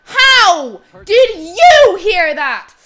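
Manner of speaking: real gamer rage yell; outraged yell. Real, not acted. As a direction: bellow it in disbelief at the screen. Yelling at game enemies.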